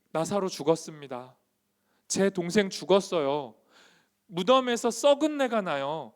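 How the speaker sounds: loud, high plea of the bereaved; desperate protest. Acted. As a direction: build to a loud, insistent outcry. Acting out Lazarus's mourners protesting to Jesus.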